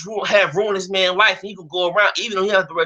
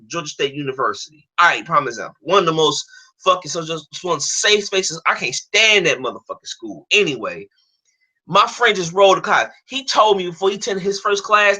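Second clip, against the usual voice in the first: usual speaking voice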